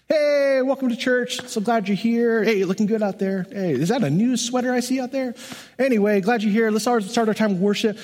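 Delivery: breezy worship leader welcome. Retold or imitated. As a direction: play the cheesy upbeat song leader greeting the room. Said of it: "I used to say this all the time".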